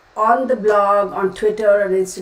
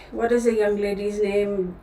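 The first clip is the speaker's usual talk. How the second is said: ordinary talk, nothing marked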